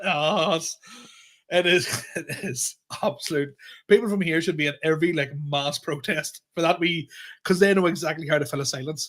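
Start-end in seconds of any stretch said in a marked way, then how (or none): none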